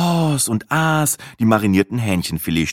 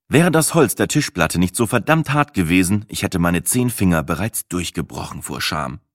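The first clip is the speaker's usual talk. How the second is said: ordinary delivery, nothing marked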